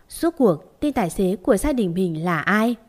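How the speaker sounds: usual speaking voice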